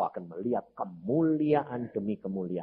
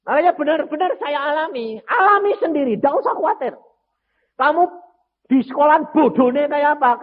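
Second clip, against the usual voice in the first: preaching; 13 semitones higher, 8 dB louder than usual. Acted. Preaching at full force.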